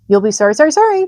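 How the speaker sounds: very high, loud honking goose cries; a goose barking orders to the pig; shrill-pitched urgent goose shouts; excited goose. A narrator's reading. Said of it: "called the goose".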